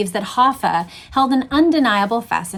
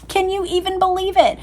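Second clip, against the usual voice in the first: laugh, then ask it much higher in amused disbelief; 9 semitones higher than usual. Real, not acted. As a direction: giggle it out in disbelief.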